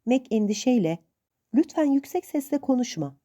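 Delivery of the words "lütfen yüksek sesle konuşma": pleading. A narrator's reading. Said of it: "diye yalvardı".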